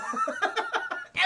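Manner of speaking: high burst of real laughter; high-pitched laugh. Real, not acted. Streamer laughing at a silly movie plot, high-pitched cackle. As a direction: crack up at the absurdity.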